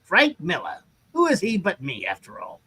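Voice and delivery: swooping, self-mythologizing showman; grand old comic-book huckster, pitch rolling up and down; boastful pitchman taking credit for everything; Stan Lee. Acted. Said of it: "Frank Miller is, i-, is Stan Lee".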